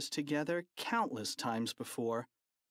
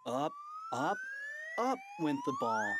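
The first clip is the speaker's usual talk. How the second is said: rising pitch. A narrator's reading. Ball rising up.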